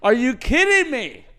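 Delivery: high, loud exasperated yell; mock outrage. Podcaster ranting about a predictable movie plot, mock outrage. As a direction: draw it out in loud, high disbelief.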